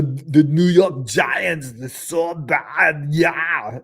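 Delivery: loud, hammed-up German accent; Arnold, German accent. Shown as a demonstration. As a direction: put on a booming bad German accent. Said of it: "I can do a German accent. I can do, uh, Arnold".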